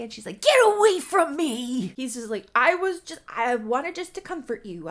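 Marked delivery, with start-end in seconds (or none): 0.4-1.9 loud, high-pitched mock yell; angry retold shout. Acted rejection of a comforter. 2.5-4.9 loud, high-pitched mock defensiveness; defensive protest. Acted startled defence after rebuff.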